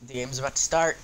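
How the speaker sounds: normal talking voice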